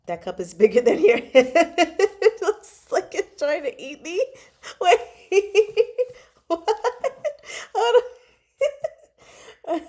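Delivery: high, loud words broken up by real laughter; laughing hard. Real, not acted. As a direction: crack up helplessly while talking. Laughing at the sight of a cup.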